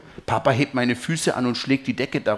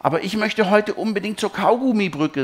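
drängendes Kind, deutlich höher; rufend; 9 semitones higher, 4 dB louder than usual. A narrator's reading. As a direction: ruf den Wunsch hoch und dringlich. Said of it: "rufe ich". (eifriger Kinderruf)

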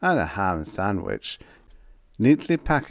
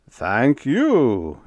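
loud, gratified thanks, swooping high then falling; glowing; 5 semitones higher, 7 dB louder than usual. A narrator's reading. General praised for his efficiency, glowing. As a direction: bask in the compliment, big and delighted. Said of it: "General Webb glowed".

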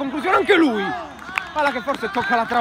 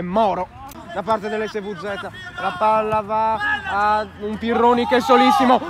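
usual speaking voice

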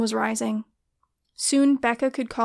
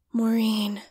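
a hushed, voiceless Maureen; murmured whisper. A narrator's reading. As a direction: whisper the name Maureen with longing. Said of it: "Ed murmured".

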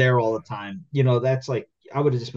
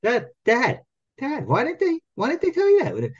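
put-on much higher, whiny kid voice; imitating the daughter; 14 semitones higher, slower than usual. Daughter teases Dad about the call, high and whiny. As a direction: needle Dad like a teenager stirring the pot. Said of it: "She goes".

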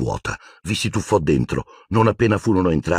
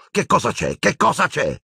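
rising from high to higher on the repeat; exasperated; 11 semitones higher than usual. A narrator's reading. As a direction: demand an answer, then push higher. Harry exasperated by friends' stares. (esasperato, sempre più acuto)

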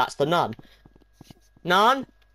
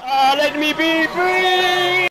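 slow, high shout to be freed; drawn-out pleading shout; 8 semitones higher, 4 dB louder, much slower than usual. Pretend. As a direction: howl it out like a captive. Begging while chased.

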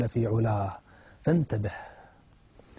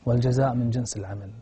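normal talking voice

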